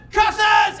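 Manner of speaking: high, loud cry to the heavens; mock yell. Dad who has hurt his shin curses the moon, mock yell. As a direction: yell it like a thwarted cartoon villain. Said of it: "raised his fist and just yelled".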